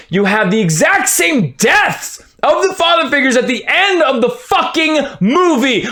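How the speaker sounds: worked-up fan ranting; heated rant. Real, not acted. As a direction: keep the fury boiling. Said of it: "I'm getting really heated".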